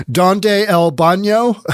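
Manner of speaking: slow, laughing gringo Spanish; mock your own bad Spanish, giggling through it; hammed-up American asking in Spanish for the bathroom; clumsy tourist Spanish. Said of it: "my Spanish apparently wasn't quite as good".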